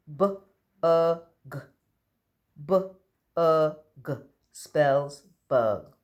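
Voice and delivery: p-a-t, each sound stretched and separate, loud. Shown as a demonstration. Teacher sounding out a word for children to guess, loudly. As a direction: sound out every letter slowly. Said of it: "I'm going to sound out the words".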